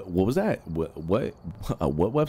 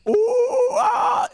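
much higher, louder monkey hoots; mock ape calls; monkey ape noises; 6 dB louder than usual. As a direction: hoot like an ape at the doll. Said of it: "I said".

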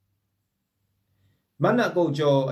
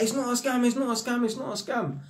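higher, repeated insistence, lightly acted; mock pleading reassurance; 8 semitones higher than usual. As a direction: retell trying to convince a wary tenant. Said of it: "proper standoffish with man. I'm like".

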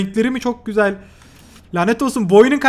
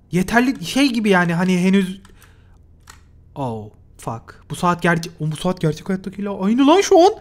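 usual speaking voice

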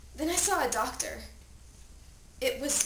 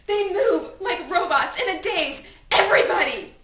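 loud, high, distraught cry; desperate plea; 6 semitones higher, 8 dB louder than usual. Acted. Dead teen at own funeral begging to wake, desperate. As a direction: beg frantically to be rescued.

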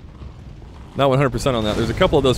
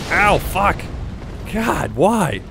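hit hard crying out; 6 semitones higher, much slower than usual. Real, not acted.

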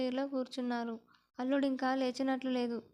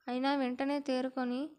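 ordinary delivery, nothing marked

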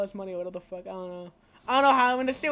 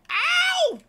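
high, loud yelp; pained yelp; 7 dB louder than usual. Real, not acted. Player hit mid-fight, high yelp.

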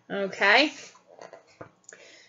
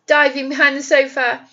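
plain reading voice

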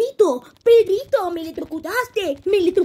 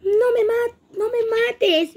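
pleading; 7 semitones higher, slower than usual. Acted. Begging not to be killed.

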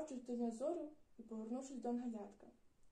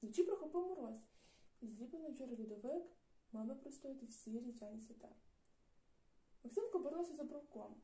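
usual speaking voice

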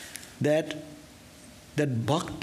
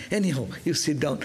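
ordinary talk

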